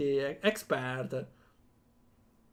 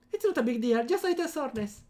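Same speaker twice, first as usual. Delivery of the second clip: put-on higher-pitched girl's pout; lightly whiny higher character voice; 7 semitones higher, faster than usual. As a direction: brush it off in a high pouty voice. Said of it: "Amy pouts like".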